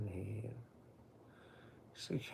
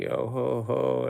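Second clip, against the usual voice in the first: mock Santa Claus; 2 semitones higher, 11 dB louder than usual. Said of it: "Like Santa Claus going".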